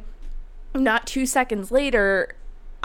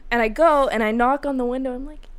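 normal talking voice

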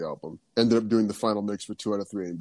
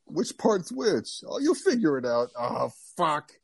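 grumbling genius; 6 semitones higher than usual. Retold or imitated. Hinted genius producer figuring the song out, mock grumbling. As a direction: mutter like a put-upon producer. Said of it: "mad genius who's like".

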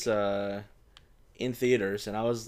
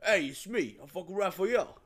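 put-on New York accent; 7 semitones higher than usual. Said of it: "Nolan North did it same".

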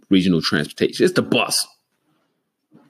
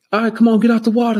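calling parent; 7 dB louder, faster than usual. Retold or imitated. Parent calling kid out of pool.

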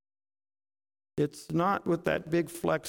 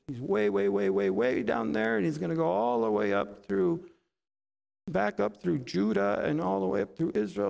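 normal talking voice

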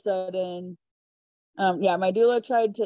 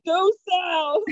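high mock yell of directions; raised, exasperated imitation of yelling; retold yell; 10 semitones higher, 4 dB louder than usual. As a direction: yell it at the driver, half-laughing. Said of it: "I had to yell at my sister".

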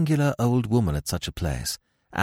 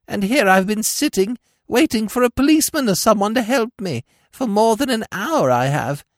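put-on whining old creature; 13 semitones higher, 4 dB louder than usual. A narrator's reading. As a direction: whimper about the fall to win sympathy. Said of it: "whined the old creature".

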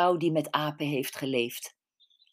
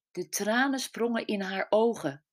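ordinary delivery

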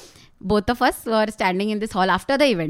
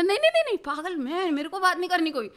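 panicked refusal; 6 semitones higher than usual. Retold or imitated. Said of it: "बोला".

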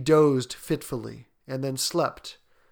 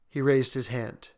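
usual speaking voice

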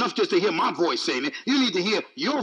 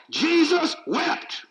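"Jesus wept" drawn out slowly and pitched higher; slow emphatic; 6 semitones higher, much slower than usual. Shown as a demonstration. Preacher showing it's in the scriptures, slow emphatic proclamation. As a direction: proclaim each word slowly, with weight.

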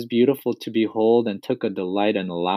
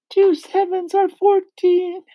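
put-on very high, strained boy's voice; gasping; 4 dB louder than usual. A narrator's reading. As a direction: squeak it out slowly, in distress. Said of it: "gasped Rupert".

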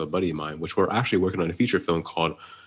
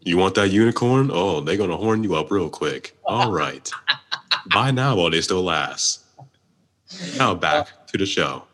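punchy opening easing into smooth announcer patter; improvised commercial voiceover; mock ad read. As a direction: sell it like a podcast sponsor spot.

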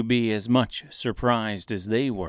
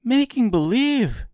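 put-on high, loud girl's disbelief; astonished cry; 14 semitones higher, 5 dB louder than usual. A narrator's reading. Dorothy shocked by the confession, high astonished cry. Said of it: "cried Dorothy".

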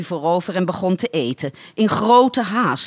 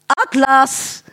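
higher, louder call for the dog; 8 semitones higher, 4 dB louder than usual. Acted. Lady calls her dog Atlas back, high and loud. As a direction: call the second dog home. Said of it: "riep ze".

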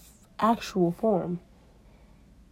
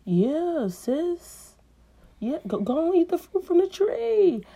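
egging snake; 10 semitones higher, slower than usual. Acted. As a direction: hype her up to eat it. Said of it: "He was like".

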